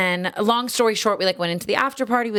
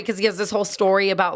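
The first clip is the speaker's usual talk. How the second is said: ordinary delivery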